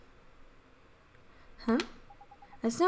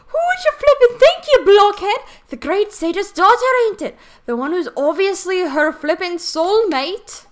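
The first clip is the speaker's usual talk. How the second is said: loud exasperated fairy sidekick; 7 semitones higher, 6 dB louder than usual. Acted. Drippy scolding Oliver.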